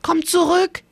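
high, urgent call. A narrator's reading. Big brother calling his brother back from the river, urgently. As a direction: call him back before it's too late. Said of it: "rief Eri".

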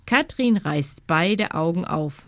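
ordinary delivery, nothing marked

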